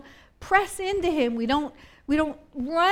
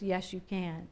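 much softer, lower encouragement; gentle reassurance; 5 semitones lower, 10 dB quieter than usual. Retold or imitated. A quiet inner voice encouraging the doubter, much softer. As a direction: gently lift him up. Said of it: "I'll hear a voice".

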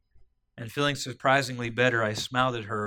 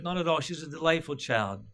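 higher-pitched, insistent reassurance; reassuring protest; 5 semitones higher than usual. A narrator's reading. Man reassuring Wendy's mother about her child, insistent. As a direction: brush off the apology with a lifted voice.

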